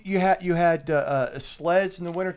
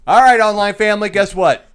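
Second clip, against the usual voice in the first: bright, loud call to viewers; loud vlog greeting; 4 semitones higher, 12 dB louder than usual. Real, not acted. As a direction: hype up the audience.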